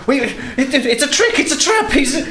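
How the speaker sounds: panicked shriek. Acted. Prance caught out, shrieking at his friend.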